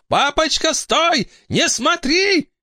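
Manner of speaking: very high, slow desperate plea; high girl voice pleading. Acted. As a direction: beg him in a high voice. Eleanor begs father to stop.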